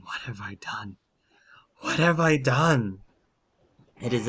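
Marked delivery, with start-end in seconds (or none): 0.0-1.0 very soft, broken-down whisper; weeping whisper. Acted. Werewolf weeping alone in the forest, whispering. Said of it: "he starts crying". 1.8-3.0 high, loud cry of despair; anguished wail. Acted. Werewolf breaking down in the moonlight, wailing. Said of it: "he starts crying".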